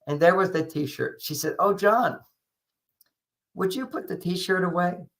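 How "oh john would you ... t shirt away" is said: light, slightly raised voice on an easy ask; simple casual request. Retold or imitated. Wife asking husband to put his T-shirt away, simple casual request. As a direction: ask sweetly, as if it's no big deal. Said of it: "Just simple as that".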